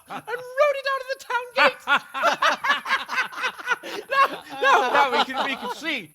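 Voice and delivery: put-on a drunk noble cackling at his own tale; drunken laughter. Drunk noble laughing through story.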